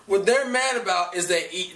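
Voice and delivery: ordinary delivery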